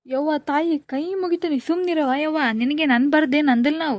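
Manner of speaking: pleading. Acted. Exasperated friend begs the crying girl, loud pleading. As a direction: hands folded, beg her to stop.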